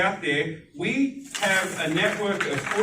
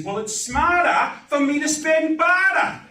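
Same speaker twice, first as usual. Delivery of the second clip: mock realisation; 10 semitones higher, 6 dB louder, slower than usual. Voicing customer's realisation.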